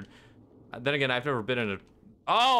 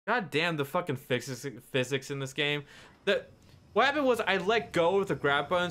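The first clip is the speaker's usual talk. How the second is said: ordinary talk, nothing marked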